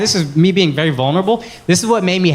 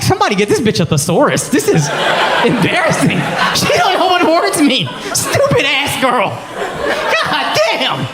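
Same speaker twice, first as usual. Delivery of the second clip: hammed-up ranting; mocking rant; 8 semitones higher than usual. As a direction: ridicule her word choice. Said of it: "I'd be like".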